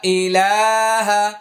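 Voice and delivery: slow chanted recitation with stretched vowels. Shown as a demonstration. Teacher reciting slowly while students hold a mirror. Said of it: "আবার আয়না ধরুন".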